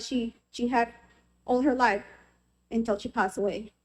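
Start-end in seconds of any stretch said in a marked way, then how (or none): none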